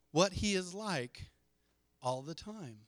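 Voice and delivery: ordinary talk, nothing marked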